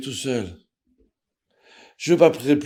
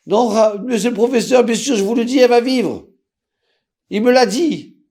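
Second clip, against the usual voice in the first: much higher and louder, urgent and pleading; excited insistence; 12 semitones higher, 6 dB louder than usual. Retold or imitated. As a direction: burst out insisting she will live. Insisting to doctor mother lives.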